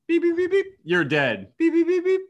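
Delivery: very high, loud beeping; game beeping. Retold or imitated. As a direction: mimic a laser tag vest beeping. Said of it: "it's like".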